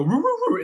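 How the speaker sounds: high playful "roo roo roo" barks; imitating a dog with high barks; mock dog barking. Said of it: "get down on all your hands and knees and go".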